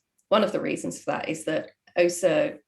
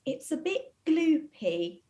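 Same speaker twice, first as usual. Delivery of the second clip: slow, high, mock-disapproving mum; high mock-mum verdict, slowed; mum's verdict; 9 semitones higher, slower than usual. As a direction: drag out gloopy like a fussy mum. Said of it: "my mum's classic review is".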